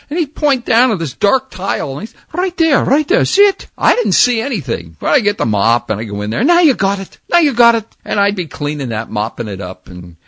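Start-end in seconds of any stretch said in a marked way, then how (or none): 2.3-3.7 higher, louder Scots-Irish brogue of a nagging janitor; old Irish or Scottish janitor scolding the boy over a missed spot. Retold or imitated. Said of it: "a little Irish or Scottish janitor". 6.4-7.8 higher, louder Scots-Irish brogue of a nagging janitor; old Irish or Scottish janitor scolding the boy over a missed spot. Retold or imitated. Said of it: "a little Irish or Scottish janitor".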